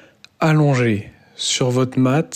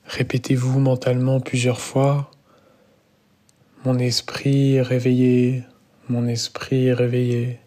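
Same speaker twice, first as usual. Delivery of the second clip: guided meditation. Real, not acted. Yoga teacher guides students lying down into Yoga Nidra, calm guided meditation.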